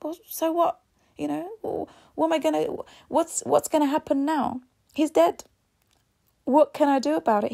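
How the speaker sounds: high, loud, mocking shrug-off of a death; retelling a cold son who laughs off his granddad's death, high and loud; callous dismissal. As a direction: toss it off like it means nothing. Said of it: "And he started laughing, and he said".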